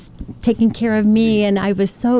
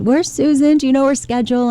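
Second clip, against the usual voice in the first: anxious father; 6 semitones higher than usual. Retold or imitated. Father anxiously phoning about daughter.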